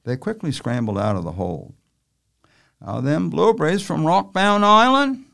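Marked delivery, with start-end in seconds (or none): 2.8-5.2 talking through a mouthful of muffin; words around a big bite, mouth full. Acted. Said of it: "Manley spoke with his mouth full".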